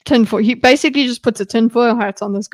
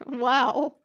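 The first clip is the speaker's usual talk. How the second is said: normal talking voice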